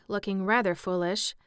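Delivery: plain reading voice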